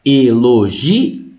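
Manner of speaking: Elogio said very slowly, i and o parted with a rising end; split into syllables. Shown as a demonstration. Teacher showing the hiatus split, drawn out slowly. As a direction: pull Elogio apart, i and o as two syllables. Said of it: "separa esse I do O aqui".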